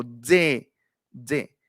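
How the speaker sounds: "D" as a buzzy "dze"; demonstrated dze; D with a little Z. Shown as a demonstration. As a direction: release each D through a small Z. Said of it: "salga con la zetita".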